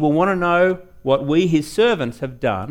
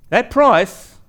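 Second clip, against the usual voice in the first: ordinary talk, nothing marked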